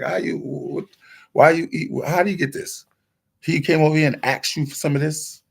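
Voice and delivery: high, rising disbelief as grandma; surprised grandma. Retold or imitated. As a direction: quiz the kid, half amazed.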